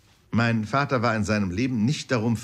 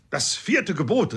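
raised, higher, barked like a parade order; drill command; 7 semitones higher, 4 dB louder than usual. A narrator's reading. Uncle Toby drilling the corporal, loud barked command. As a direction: snap it out like a drill sergeant. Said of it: "erhob die Stimme und sprach hastig wie ein Exerzierkommando".

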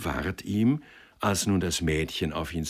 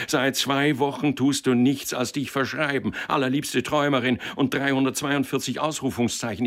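higher, mocking tirade; angry ranting; 6 semitones higher than usual. Lawyer mocking the clerk's botched petition, angry. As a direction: read his blunder back in scorn. Boss scolding.

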